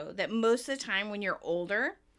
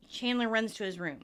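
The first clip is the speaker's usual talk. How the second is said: ordinary talk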